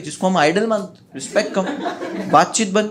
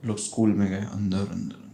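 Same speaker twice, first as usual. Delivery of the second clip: softer and softer until almost gone; fading away; 8 semitones lower, 10 dB quieter than usual. Retold or imitated. Friends disappearing deeper into the haunted school, fading. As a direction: let each word sink into the dark.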